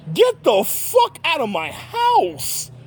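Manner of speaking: hammered out high and slow; mock furious kick-out; retold yell. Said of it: "I was like".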